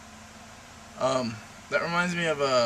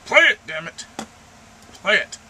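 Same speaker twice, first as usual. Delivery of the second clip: loud, raised-pitch command; exasperated yelling; 6 semitones higher, 11 dB louder than usual. Real, not acted. Vlogger ordering the computer to play, exasperated yell. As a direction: yell it at a machine that won't obey.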